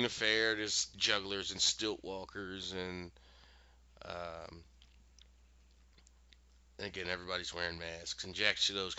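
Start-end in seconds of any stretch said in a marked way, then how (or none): none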